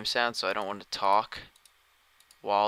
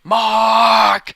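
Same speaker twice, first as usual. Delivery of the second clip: very loud, high, drawn-out holler of a name; excited shout; 12 semitones higher, 17 dB louder than usual. Pretend. Streamer greets Mark joining the stream, excited shout. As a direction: belt out the name with hyped-up excitement. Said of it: "I need to sound excited".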